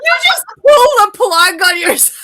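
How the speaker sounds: loud laughing through the words; laughing out loud while joking. Real, not acted. Joking about pulling the plug.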